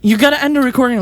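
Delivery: high, insistent call to stop; urgent interjection. Acted. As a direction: cut in suddenly, pitch jumping up. Patient calls out, stopping session's end.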